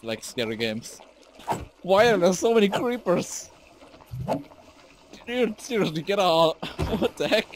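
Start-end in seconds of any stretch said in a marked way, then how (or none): 1.8-3.5 high, loud cry of disbelief; real rattled yell; loud outburst. Real, not acted. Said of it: "that was fucking loud". 5.3-7.5 high-pitched exasperated shout; rattled, half-laughing protest; loud outburst. Real, not acted. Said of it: "that was fucking loud".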